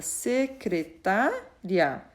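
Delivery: "secretária" slowly as se, cre, tá, ria; measured beats on each syllable of "secretária"; split into syllables. Shown as a demonstration. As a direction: divide "secretária" for a class, syllable by syllable. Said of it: "A separação de sílabas".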